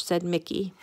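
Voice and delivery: ordinary delivery, nothing marked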